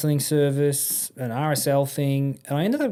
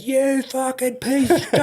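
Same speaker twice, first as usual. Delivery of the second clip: very high, drawn-out mock scream; strained, slow comic rage; 12 semitones higher, 3 dB louder, slower than usual. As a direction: imitate someone screaming down the phone. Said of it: "screaming at his phone".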